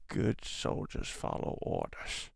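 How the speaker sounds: a soft, slow, muffled try at a nasal clone soldier; nasal Crosshair impression. Fan's superchat asks [name] for Crosshair, quiet slow nasal attempt. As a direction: mumble it like a sniper clone through your nose, drawn out and quiet. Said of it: "do it through your nose".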